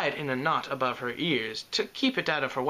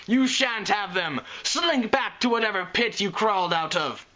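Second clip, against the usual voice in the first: defiant shout; 6 semitones higher than usual. Acted. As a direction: drive the monster off with scorn. Unicorn shouting at the dragon.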